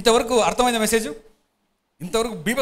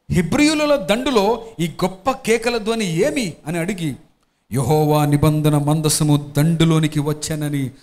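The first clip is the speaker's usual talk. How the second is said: slow, louder, measured reading of scripture; 3 semitones lower, 3 dB louder, slower than usual. Acted. Pastor reading scripture to the congregation, slow and solemn. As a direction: read it out to the congregation, weighty and slow. Pastor reads Bible passage aloud.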